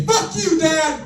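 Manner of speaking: put-on high, loud teenage yell; teen shouting. Teenager yells at his dad in defiance, high and loud. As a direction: scream it back like a defiant teen. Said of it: "stand up to me and say".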